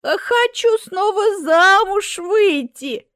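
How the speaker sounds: high, slow, loud granny wish; drawn-out elated old-lady announcement; eager old woman. A narrator's reading. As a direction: declare it grandly, stretching the words.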